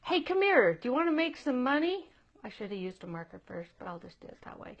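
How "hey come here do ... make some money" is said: put-on higher, brighter calling out to the kid; eager parent call. A parent calling kid number two over, eager. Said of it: "you're like".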